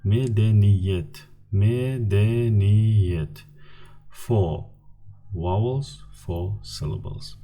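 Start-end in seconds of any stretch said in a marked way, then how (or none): 0.0-3.4 medeniyet drawn out slowly, every syllable spaced; very slow teacher model of medeniyet; slow model word. Shown as a demonstration. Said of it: "and the last word".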